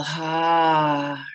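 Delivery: open-mouthed ha on the out-breath; breathy exhale. Shown as a demonstration. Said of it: "And exhale".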